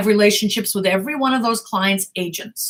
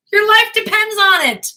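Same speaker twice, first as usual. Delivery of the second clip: higher and louder, "life" hit hard; emphatic punch line; 11 semitones higher, 4 dB louder than usual. Real, not acted. Manager warning would-be actors, higher and louder. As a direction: drive the point home like a warning. Hammering home the point.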